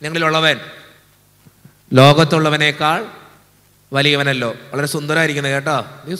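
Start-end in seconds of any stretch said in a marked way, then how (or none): 0.0-4.5 slow, louder, full recitation of the verse; recited again clearly. Real, not acted. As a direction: proclaim the verse word by word. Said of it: "ഒന്നുകൂടി പറഞ്ഞേ".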